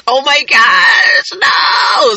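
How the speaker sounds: high, drawn-out cry of protest; hammed-up horror, stretch it out high; mock dismayed wail. Woman realizing she is getting older, mock wail.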